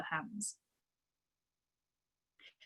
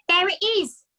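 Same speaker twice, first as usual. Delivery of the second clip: much higher, louder shout; cry out; 10 semitones higher, 9 dB louder than usual. A narrator's reading. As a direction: brother shouting in alarm. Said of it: "cried the brother".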